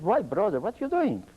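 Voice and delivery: high-pitched retold disbelief; startled protest. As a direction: question him in surprise, pitch leaping up. Retold protest after the warning shot.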